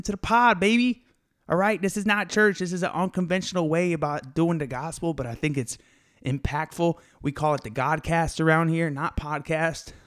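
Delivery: high-pitched, hyped-up podcast host; real excitement, a little out of breath. Real, not acted. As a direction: pump up the listeners, pitch riding high. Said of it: "out of breath 'cause I'm hype".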